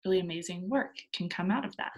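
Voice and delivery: ordinary talk, nothing marked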